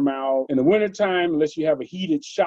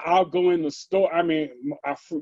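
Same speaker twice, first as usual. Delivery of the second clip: ordinary talk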